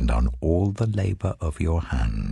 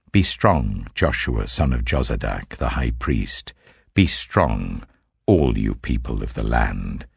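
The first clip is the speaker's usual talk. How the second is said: lower, solemn divine voice; a measured, lower voice of the Lord giving commands; 8 semitones lower than usual. Acted. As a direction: speak as God addressing his prophet, gravely. Voicing the Lord's words.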